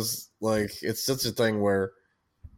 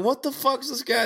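much higher, incredulous squawk; baffled onlooker. Retold or imitated. City folk spot the whooping man, high and baffled. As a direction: sound like a bystander who can't believe it. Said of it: "This is like".

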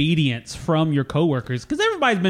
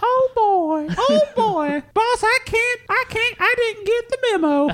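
high squeaky Mickey voice; Mickey Mouse voice. Pretend. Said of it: "I think he needs a Mickey Mouse voice".

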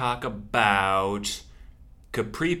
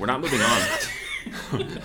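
slow laughing insistence; much slower than usual. Real, not acted.

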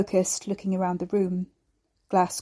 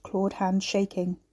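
ordinary delivery, nothing marked

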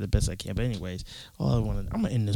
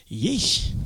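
laughing; 12 semitones higher, 6 dB louder than usual. Real, not acted. Laughs at own joke.

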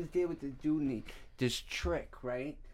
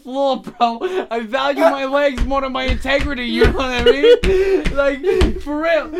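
excited shout; 7 semitones higher, 14 dB louder than usual. Real, not acted. Peak of the story, shouting.

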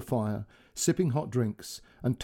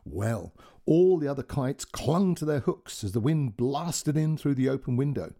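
plain reading voice

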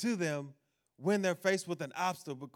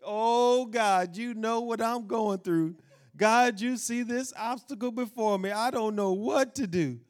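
higher, louder whiny plea to God; mock whining prayer; 4 semitones higher, 4 dB louder, slower than usual. Believer whining to God about his obstacle, hammed-up. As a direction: ham up a self-pitying prayer. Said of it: "what we love to do is cry out to God".

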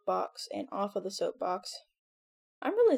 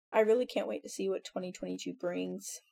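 ordinary talk, nothing marked

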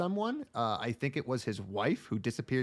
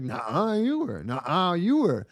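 put-on childish bickering; 4 semitones higher, 3 dB louder than usual. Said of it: "And he's like".

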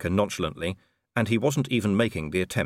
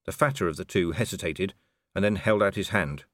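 ordinary delivery, nothing marked

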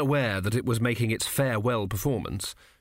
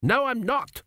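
high, sharp snap; snapped; 12 semitones higher than usual. A narrator's reading. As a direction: snap back, irritated. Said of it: "snapped the king".

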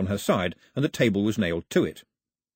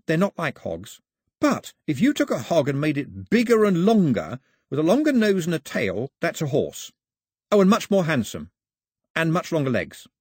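higher, animated explainer; lively Pilou; 6 semitones higher than usual. Acted. Pilou describes a horse to Mao, higher and animated. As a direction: explain it keenly, pitched up.

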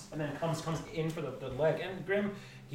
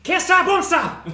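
sing-song yell far higher and louder; gleeful taunt; 14 dB louder than usual. Acted. The woman keeps hounding Grim, taunting shout. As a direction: taunt him at the top of your voice. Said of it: "he goes".

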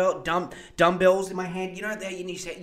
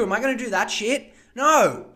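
ordinary talk

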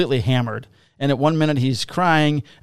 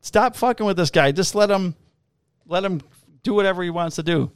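exasperated firemen; 6 semitones higher than usual. Retold or imitated. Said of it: "they're like".

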